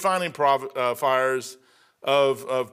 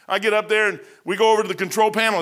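ordinary delivery

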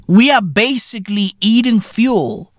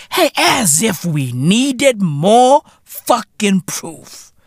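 usual speaking voice

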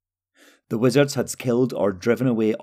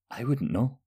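uneasy, shuddering; 3 semitones higher, 6 dB quieter than usual. A narrator's reading. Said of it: "Talgo shuddered".

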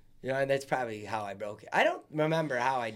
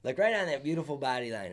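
ordinary talk, nothing marked

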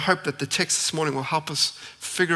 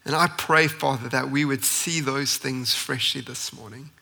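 opening prayer; 2 semitones lower than usual. Acted. Pastor prays before sermon.

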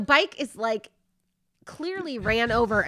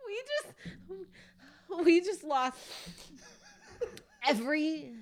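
weak, tearful words between real laughs; crying with laughter; 4 semitones higher, 5 dB quieter, much slower than usual. Real, not acted. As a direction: barely get it out, choked with laughter. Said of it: "I really am crying at this moment".

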